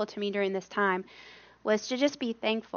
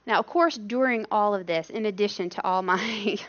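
usual speaking voice

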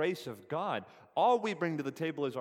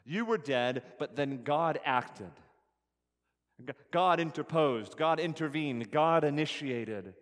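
emphatic preaching; 5 dB louder than usual. Acted.

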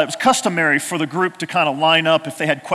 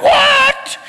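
much higher, loud, laughing cry of shock; surprised shout; 6 dB louder than usual. Retold or imitated. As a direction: yelp like you just got hit with a balloon. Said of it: "they're like".

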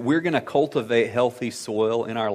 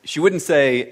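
normal talking voice

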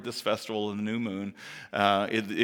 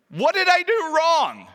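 anguished plea; 7 dB louder than usual. Retold or imitated. Rhetorical anguished question.

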